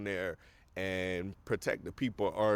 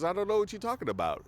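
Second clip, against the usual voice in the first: calm denial; 10 semitones higher, much faster than usual. Retold or imitated. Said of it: "Lex is very calm".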